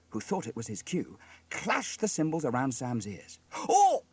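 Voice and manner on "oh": high, loud "oh" of shock; pained cry. A narrator's reading. Sam gets cymbals round his ears, crying out. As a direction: yelp as cymbals clash by the ears. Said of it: "cried Sam".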